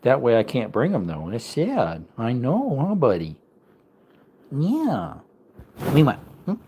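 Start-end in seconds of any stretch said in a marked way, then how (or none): none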